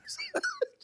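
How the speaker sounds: short laugh. Real, not acted. Host laughs at own story.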